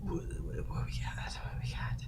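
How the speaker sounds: breathy hushed question to himself; whispered. Real, not acted. Streamer scanning chat, whispering to himself.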